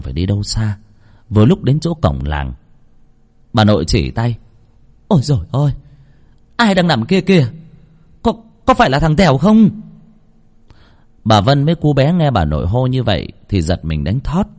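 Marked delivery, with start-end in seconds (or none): 5.1-9.7 alarmed cry. A narrator's reading. Grandmother spotting the boy lying by the gate, alarmed.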